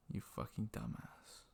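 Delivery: unvoiced, breathy jab at himself; hushed mock insult. Manager whispering an insult at him, hushed. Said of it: "she didn't say fucking".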